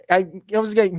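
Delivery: ordinary talk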